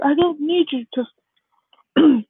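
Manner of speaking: put-on high, protesting boy voice; retold child arguing back; upset insistence. Crying Deku to mom after telling her already, upset.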